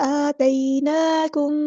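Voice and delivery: आतैनाकुम recited slowly, the madd stretched long; drawn-out recitation. Shown as a demonstration. Teacher reciting to students, slow drawn vowels. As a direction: chant आतैनाकुम drawing out each long vowel. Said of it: "अलिफ पे खड़ा ज़बर है तो मद इसलिए करेंगे".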